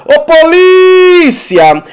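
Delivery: high, loud, drawn-out call; mock shout for the police. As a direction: hail the police from afar. Calling out to the police.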